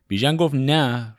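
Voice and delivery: ordinary delivery, nothing marked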